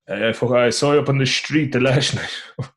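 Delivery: imitated Irish dad, every S slurred to sh; west of Ireland accent. Shown as a demonstration. Showing his dad's S sounds, thick west of Ireland accent. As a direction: put on west of Ireland, "street" said "shtreet". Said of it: "like a H just coming after the S".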